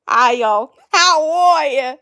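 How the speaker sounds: put-on loud, drawn-out Alabama farm-girl greeting; hammed-up Alabama drawl; Alabama accent. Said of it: "she's from Alabama".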